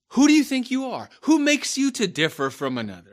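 loud rebuke. Retold or imitated. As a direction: raise the voice, demanding. Preacher voicing Paul's rebuke, louder.

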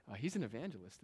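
ordinary delivery

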